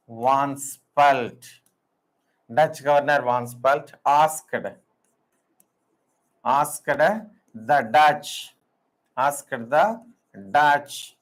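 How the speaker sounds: halting dictation, phrases repeated after long pauses; slow dictation. Acted. Teacher dictating the Ambon story to students, slow and halting. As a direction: read it out piece by piece so students can write.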